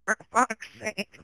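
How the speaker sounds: high, slow bleating goat voice. Shown as a demonstration. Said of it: "but, like, in the goat voice".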